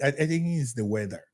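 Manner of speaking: ordinary talk, nothing marked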